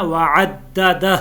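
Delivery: usual speaking voice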